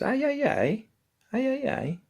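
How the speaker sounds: very high imitated woman's voice; high-pitched ghostly female voice, imitated; woman calming dog. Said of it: "trying to calm the dog down".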